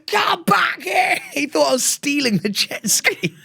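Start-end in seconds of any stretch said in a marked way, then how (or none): 0.1-1.2 high, slow, stretched-out shout; boat guy shouting. Retold or imitated. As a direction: call them back across the water. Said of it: "The guy on the boat's going".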